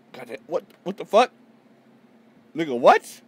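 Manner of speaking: high, loud disbelieving outbursts; real shock. Real, not acted. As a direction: yelp in escalating astonishment. Shocked reaction to the page.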